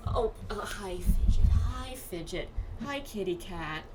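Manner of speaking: ordinary talk, nothing marked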